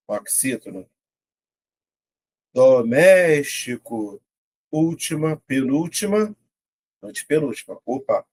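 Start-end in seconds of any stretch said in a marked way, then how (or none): 2.5-4.2 "Doméstico" slowed, MÉS swelled and held; syllable by syllable. Shown as a demonstration. Teacher finding the tonic syllable aloud, syllable by syllable. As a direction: draw out "Doméstico", leaning on MÉS. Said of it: "Vou separar".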